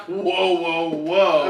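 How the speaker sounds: loud drawn-out whoa. Retold or imitated. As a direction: call out whoa whoa whoa. Maven interrupting, mocked.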